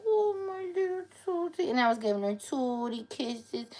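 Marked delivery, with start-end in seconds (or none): 0.1-1.7 put-on high cooing baby voice to the dog; baby talk. Mom retelling how she cooed to her dog, high baby talk. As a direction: coo it like to a newborn. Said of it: "And I was like".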